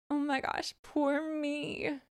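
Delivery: mock pity-me whine. Coach mocking a self-pitying apology, whiny and soft. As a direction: play the poor victim, soft and high.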